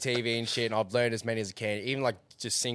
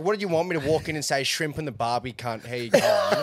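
mock broad Aussie greeting, half laughing; exaggerated Aussie; 5 semitones higher, 5 dB louder than usual. Traveller mocking what Brits expect of Aussies, hammed-up Aussie accent. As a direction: ham up the Aussie, louder as it goes. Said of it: "would have fucking known I'm Aussie".

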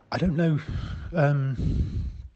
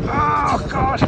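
freezing cold; 6 semitones higher than usual. Real, not acted. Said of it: "I'm freezing cold".

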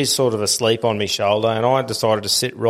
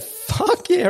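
much higher, eager burst on Fuck; eager yes. Retold or imitated. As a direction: jump at the chance to talk. Eager reply to reporter.

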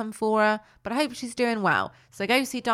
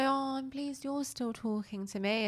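high, soft, slow little voice; girlish put-on gratitude; happy Noddy; 6 semitones higher, 9 dB quieter than usual. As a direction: imitate a relieved toy, high and gentle. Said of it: "is so happy".

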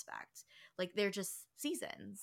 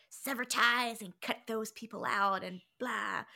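louder, hammed-up drastic advice; mock-severe proclamation, pushed louder; mock dramatic; 5 semitones higher, 5 dB louder than usual. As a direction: overdramatize it, then toss off the blah. Mocks drastic advice she rejects.

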